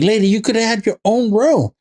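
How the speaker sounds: high exasperated complaint; mock annoyed. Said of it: "I'm like".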